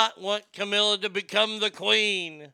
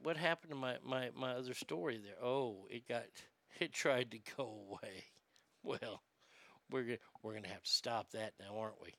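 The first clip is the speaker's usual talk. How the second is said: muttering under breath; 8 semitones lower, 16 dB quieter than usual. Real, not acted. Muttering while fixing the screen.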